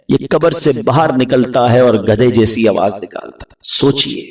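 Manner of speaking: ordinary delivery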